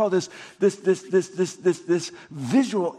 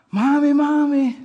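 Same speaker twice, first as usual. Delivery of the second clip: put-on drawn-out, louder child's cry for mommy; child calling mommy; 7 semitones higher, 5 dB louder than usual. Little boy calling his mom from his cot in the dark, a drawn-out cry. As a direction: call out like a scared little boy.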